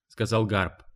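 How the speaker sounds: plain reading voice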